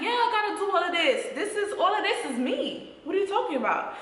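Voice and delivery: high-pitched, defensive comeback; indignant retort. Retold or imitated. She answers her date questioning her outfit, indignant. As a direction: retell it as a put-out, high-voiced retort.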